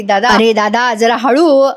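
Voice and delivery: slow teasing words through laughter; laughing. Retold or imitated. Jeeva teasing his elder brother, slowly laughing. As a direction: tease him while laughing.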